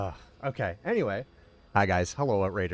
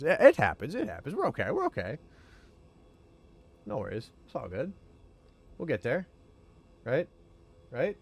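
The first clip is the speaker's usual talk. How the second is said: reassuring; 6 semitones higher than usual. Real, not acted. Botched attempt, calming himself down.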